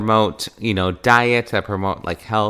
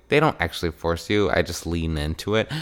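much softer, quick little admission; quiet aside; 6 semitones lower, 8 dB quieter, faster than usual. Real, not acted. Admitting to listeners she just leans in, softly. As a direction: drop the voice for a sheepish aside. Aside undercutting own claim.

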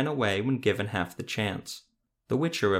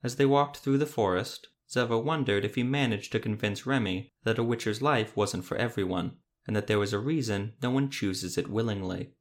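ordinary delivery, nothing marked

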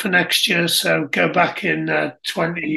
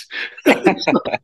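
unvoiced amused laugh; 8 semitones higher than usual. Real, not acted. Amused reaction to story.

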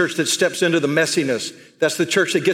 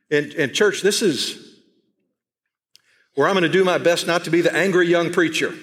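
ordinary talk

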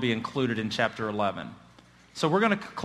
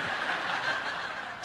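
soft breathy chuckle; quiet laugh; 8 dB quieter than usual. Real, not acted. Preacher chuckling after his joke to the congregation, soft laugh. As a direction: let a soft laugh slip out.

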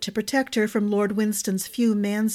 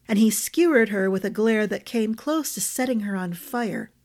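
plain reading voice

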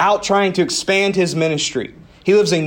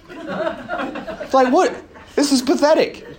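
laughing disbelief; 9 semitones higher, slower than usual. Retold or imitated. Retelling his own fall while skiing.

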